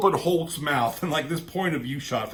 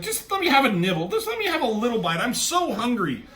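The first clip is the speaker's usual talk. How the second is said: high, rushed mock begging; hammed-up desperate wheedling; imitated pleading; 11 semitones higher, 5 dB louder than usual. Wasp's head pleads for a bite.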